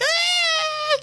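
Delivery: high screaming cry; crowd scream. Retold or imitated. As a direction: scream like the whole car. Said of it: "everyone's like".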